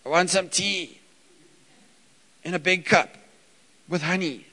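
put-on high, loud little-kid whine; little boy pleading for his tea; toddler asking for tea. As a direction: play the insistent three-year-old. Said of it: "we know he's awake 'cause we get".

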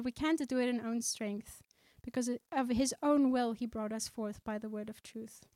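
ordinary delivery

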